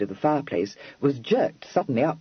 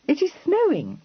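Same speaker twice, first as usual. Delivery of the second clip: higher and louder, incredulous cry; cried out; 3 dB louder than usual. A narrator's reading. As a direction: toss it back in disbelief. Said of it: "cried Miss Charing".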